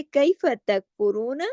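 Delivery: कैफ़ तकफ़ुरून read slowly, word by word; slow teaching demo; word by word recitation. Shown as a demonstration. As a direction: model each word clearly for the class. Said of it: "एक एक लफ्ज़ करके पढ़ भी लें".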